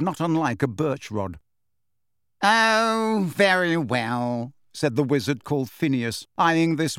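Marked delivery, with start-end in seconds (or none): 2.4-4.5 a reluctant, drawn-out very well; giving in with ill grace, slow; grudging. A narrator's reading. Phineas yielding to the witch, grudging and slow.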